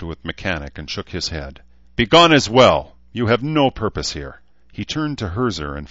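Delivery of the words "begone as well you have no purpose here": loud, higher-pitched banishing order; stern command that opens at a shout; imperious dismissal. Acted. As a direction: send them packing with force. Elf angrily dismisses the five.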